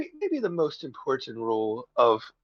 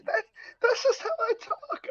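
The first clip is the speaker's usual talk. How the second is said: squeaky cracked voice, way up high; voice cracking; 4 dB quieter than usual. Real, not acted. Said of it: "I cracked my voice".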